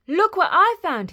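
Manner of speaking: high, loud, proud reveal; excited boy. A narrator's reading. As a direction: show off a find excitedly. Jack proudly shows the hen.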